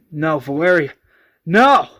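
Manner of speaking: loud, rising acted cry; pleading shout after someone leaving; desperate protest. Wounded man protests being left.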